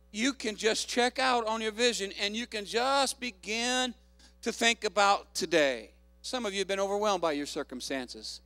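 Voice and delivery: ordinary delivery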